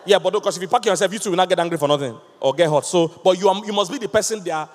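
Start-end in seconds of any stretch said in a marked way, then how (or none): none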